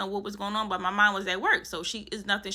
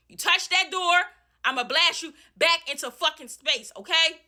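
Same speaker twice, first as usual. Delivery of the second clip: furious yelling; 5 semitones higher than usual. Retold or imitated. Tenant threatens landlord at the door, high angry yell. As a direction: drive them from the door.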